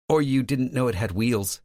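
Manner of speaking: sassy. A narrator's reading. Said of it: "she sasses back".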